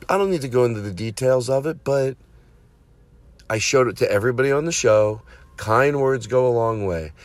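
ordinary talk

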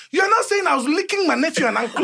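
indignant dad. Retold or imitated. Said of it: "Dad, remember".

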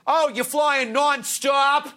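high, drawn-out Boston transit cop; hammed-up, slow Boston accent; thick Boston accent. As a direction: imitate a Boston cop, stretching the vowels. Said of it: "No, no, that's a Boston accent".

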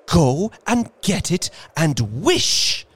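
high, urgent cry; quivering excitement. A narrator's reading. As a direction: command it in feverish excitement. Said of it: "quivering with excitement".

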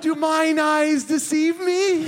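high, slow Shakespearean wonder; old-fashioned grand voice. Retold or imitated. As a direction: declaim like a stage actor. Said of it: "she was like".

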